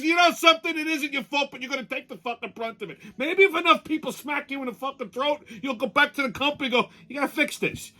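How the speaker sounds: high-pitched indignant rant; fired-up high complaint; retold rant. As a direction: vent the outrage about the hotel. Retold lecture to clerk.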